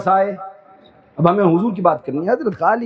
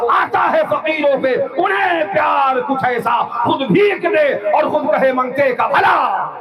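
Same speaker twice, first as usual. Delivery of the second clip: declaimed verse; 6 semitones higher than usual. Acted. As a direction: proclaim the couplets to the gathering. Recites poetry loudly to crowd. (بلند آواز میں اشعار پڑھنا)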